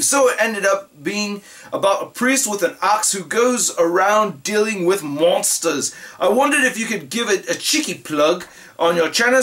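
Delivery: put-on amateur British accent, reading aloud; imitation British letter-reader. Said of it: "do this in the British accent".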